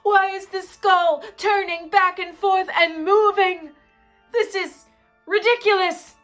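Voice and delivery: loud, high alarm; panicked outburst. Acted. Gravediggers reacting to a turning skull, loud panic. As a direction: cry out in hammed-up panic.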